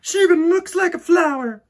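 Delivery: put-on high, loud bossy little girl; snooty girl. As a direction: look down your nose at her. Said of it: "said Victoria".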